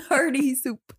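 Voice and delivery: giggling echo; laughing. Real, not acted. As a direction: laugh through the words.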